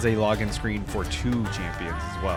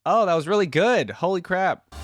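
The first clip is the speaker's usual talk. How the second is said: real burst of delight, higher and louder; excited, impressed; 8 semitones higher, 6 dB louder than usual. Real, not acted. Reactor praising the song that just ended, excited and impressed. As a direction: blurt it out, thoroughly impressed.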